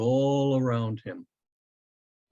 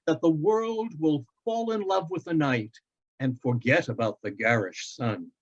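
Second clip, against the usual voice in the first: recited verse; 5 semitones higher than usual. Acted. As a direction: recite it like verse under the stars. Father quoting Shakespeare.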